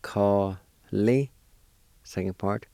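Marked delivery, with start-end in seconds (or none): 0.0-1.3 "Ka, Li" as two held, separate syllables. Shown as a demonstration. Teacher showing learners the first part of handful, syllable by syllable. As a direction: isolate each sound of "Ka, Li". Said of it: "First part is pronounced like this".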